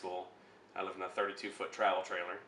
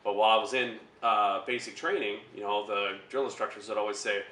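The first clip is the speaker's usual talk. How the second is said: ordinary talk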